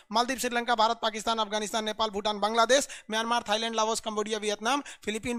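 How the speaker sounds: names run together without pauses; quick memory drill, one name straight into the next; rapid-fire recitation. Shown as a demonstration. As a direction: rattle off the country list at speed. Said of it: "एकदम तेजी से speed में".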